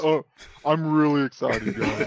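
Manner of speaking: mock deadpan with a laugh; flat, laughing fake excitement; emotionless robot. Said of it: "how excited are you?".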